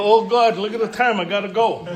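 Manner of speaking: loud, hammed-up excuse to flee; mocking an awkward normie. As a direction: play a flustered person bolting from the chat. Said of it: "They go".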